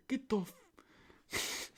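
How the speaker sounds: high-pitched mock Irish accent; Conor McGregor. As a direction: start a brash Irish fighter's outburst. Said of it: "we gotta do Conor McGregor".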